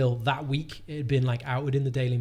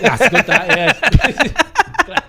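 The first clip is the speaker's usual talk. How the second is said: much louder and higher, laughing through it; 10 semitones higher, 12 dB louder, slower than usual. Real, not acted. Answering the co-host's nonchalantly correction, laughing loudly. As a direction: burst out laughing mid-protest.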